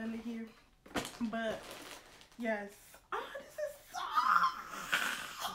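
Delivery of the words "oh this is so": high, slow, squealing with joy; overjoyed squeal; overwhelmed squeal. Real, not acted. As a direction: squeal it out, near tears of delight. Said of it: "I think I'm gonna cry".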